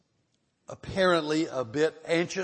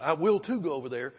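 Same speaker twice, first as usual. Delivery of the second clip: insistent "will too", punched louder; emphatic; 3 semitones higher, 4 dB louder, faster than usual. Shown as a demonstration. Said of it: "emphatic. It's kinda like we say".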